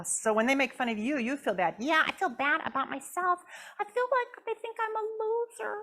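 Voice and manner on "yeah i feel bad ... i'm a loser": puppet Jasmine greeting. Acted. Puppet Jasmine greets the audience in a high squeaky voice. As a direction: pop up and chirp hi.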